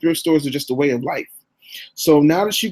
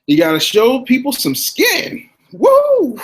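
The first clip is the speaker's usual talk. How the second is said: higher, louder, drawn-out tease ending in a whoop; playful hype; 10 semitones higher, 5 dB louder, slower than usual. Real, not acted. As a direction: tease the crowd then let out a whoop. Teasing crowd before "skin" joke.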